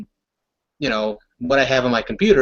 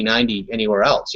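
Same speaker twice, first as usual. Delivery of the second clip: ordinary delivery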